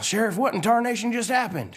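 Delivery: high-pitched bewildered country truck; Mater. Acted. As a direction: demand an explanation, voice way up. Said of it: "He's all".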